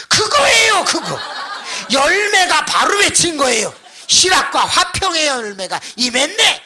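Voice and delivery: high, loud, triumphant declaration; excited proclamation. Retold or imitated. Pastor telling the deacon the fruit has come, loud excited proclamation. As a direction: pounce on it like a breakthrough.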